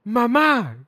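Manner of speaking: put-on high child's voice; little girl calling mom. Ten-year-old calling her mom, in a high child's voice. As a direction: tug and whine like a small kid. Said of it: "And she goes".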